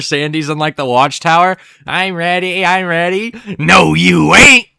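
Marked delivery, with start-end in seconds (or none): 1.9-3.3 lightly higher, excited chant; eager SpongeBob. Retold or imitated. SpongeBob in the watchtower, mock eager voice. 3.6-4.7 louder, slow, drawling put-down; loud Texan retort. Retold or imitated. Sandy shutting down SpongeBob, loud mock drawl.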